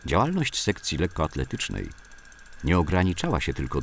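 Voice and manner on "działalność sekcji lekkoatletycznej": usual speaking voice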